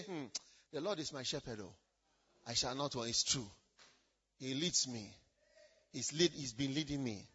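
low, soft reverent murmur; hushed psalm recitation. Pretend. Believer at the end of life reciting the psalm, hushed and low. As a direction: recite it like a dying man at peace.

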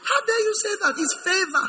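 high-pitched mock indignation; indignant rebuke.

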